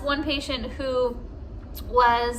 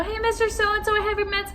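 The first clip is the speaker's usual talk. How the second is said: chirpy greeting; 9 semitones higher than usual. Retold or imitated. Cheery nurse greeting retold.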